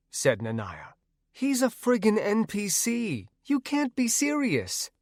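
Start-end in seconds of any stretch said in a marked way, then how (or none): 1.3-4.9 put-on much higher, incredulous young woman; high scornful girl. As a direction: scoff at the idea. Trinity protests.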